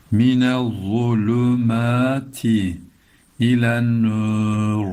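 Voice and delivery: "ilen nur" ending on a heavy, thick r, slow. Shown as a demonstration. Teacher reads "Minel zulümati ilen nur" slowly, thick r. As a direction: stop on a dark r in "ilen nur". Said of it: "Ra'yı da kalın okuyacağız".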